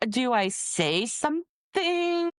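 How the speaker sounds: high, drawn-out uncertain question; acted hesitation. New employee unsure about reporting, acted hesitation. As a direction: stretch it out like an awkward dilemma. Acting out her awkward dilemma.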